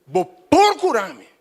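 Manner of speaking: a begging prayer voiced for the church; high, loud, drawn-out pleading prayer. Shown as a demonstration. Preacher voicing a believer pleading to the Lord, loud and drawn out. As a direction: stretch se você up high, then cry out vou procurar-me. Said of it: "E diz".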